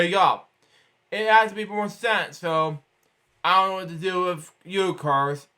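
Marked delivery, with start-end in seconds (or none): none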